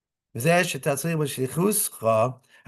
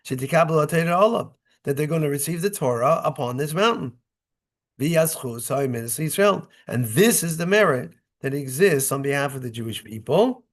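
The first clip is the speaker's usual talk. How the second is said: ordinary delivery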